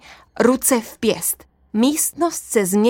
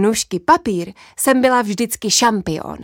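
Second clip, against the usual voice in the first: ordinary delivery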